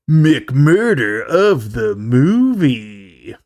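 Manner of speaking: very slow, swooping announcer voice; dramatic segment announcement. Acted. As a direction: stretch out every word like a title reveal. Announcing a named show segment.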